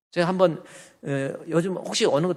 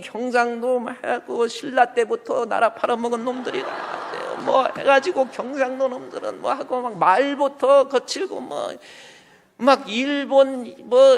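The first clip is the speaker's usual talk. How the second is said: loud, high-pitched mock tirade; hammed-up ranting, high and loud; imitating online ranters; 7 semitones higher, 4 dB louder than usual. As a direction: mimic hostile commenters in a raised voice. Mimicking hostile online comments about Gyeongsang.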